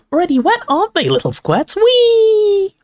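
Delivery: very high, gleeful imp squeal; taunting poltergeist. A narrator's reading. Peeves pelting students with water, gleeful taunting. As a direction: taunt shrilly, ending in a loud squeal.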